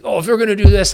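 mock the petty outrage at his own pay; ridiculous rant. Retelling a ridiculous rant in his head, loud mock outrage. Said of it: "had this ridiculous rant in my head".

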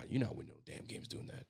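under the breath, voiceless; frustrated mutter. Real, not acted. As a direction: mutter it to yourself in disgust.

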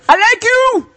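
high, loud mock African accent yelling the line; terrible African impression. Host hams up a scammer's greeting in a bad African accent. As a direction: yell it like a scam email with a bad African accent. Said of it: "that is a terrible African impression".

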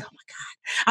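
breathless whisper of dismay; whispered oh my God. Retold or imitated. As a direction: whisper it in panic. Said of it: "I was scared to be like".

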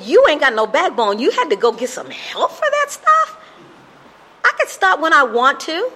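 cocky young addict mocking the meetings; defiant denial. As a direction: brush off the advice, sure he's fine. Defiantly brushing off brother's advice.

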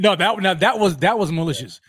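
loud, high-pitched condemnation; emphatic indignation. Real, not acted. Co-host condemning Gronk's hit on Dre, emphatic indignation. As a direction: come down hard on it, indignant. Angry recall of a dirty play.

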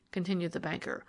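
plain reading voice

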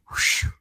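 loud blown-out breath of relief; relieved whew. Retold or imitated. Reporter spared the interview, relieved exhale. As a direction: exhale hard, danger passed.

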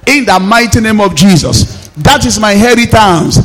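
loud, insistent preaching declarations; =forceful, ringing revival prayer; full-force prayer. Acted. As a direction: hammer out each declaration for the congregation.

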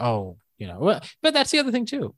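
usual speaking voice

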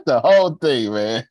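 laughing it out loud and high. Real, not acted. Laughing along at a listener's joke about throwing it all away. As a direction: burst out laughing through the words.

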